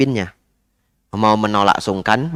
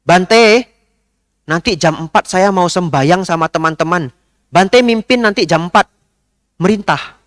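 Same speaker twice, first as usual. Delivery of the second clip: high, loud commanding lady; imitating a pushy lady, high and loud; bossy lady; 7 semitones higher, 3 dB louder than usual. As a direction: order the monk about like a pushy lady. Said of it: "Ibu ini bisa tiba-tiba seperti ini".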